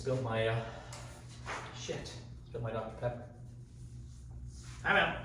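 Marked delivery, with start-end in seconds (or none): 1.8-3.2 swearing under the breath; muttered curse. Real, not acted. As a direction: curse quietly to yourself. Spilled drink mid-video, curse.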